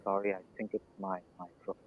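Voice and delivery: quick, faint, far-off mic; speaking from far away; barely audible. Real, not acted. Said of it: "it's very, very low".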